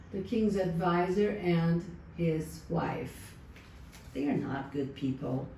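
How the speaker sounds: ordinary delivery, nothing marked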